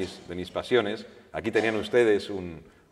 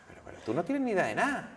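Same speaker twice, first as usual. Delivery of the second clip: put-on Andalusian accent; 9 semitones higher, 4 dB quieter than usual. Flamenco singer scoffing at reading to the reporter, Andalusian accent. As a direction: scoff it in a rough Jerez Andalusian accent, pitched up. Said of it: "con su acento así".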